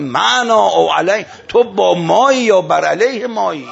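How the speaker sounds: high, loud, ringing challenge; commanding shout. Retold or imitated. Imam challenging the man as his family trembles, a ringing shout. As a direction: cry out the demand with stretched vowels. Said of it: "سرش داد زد".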